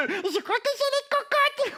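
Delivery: very high little puppet voice; eager puppet character. Pretend. Cookie tries another wrong word, high eager puppet voice.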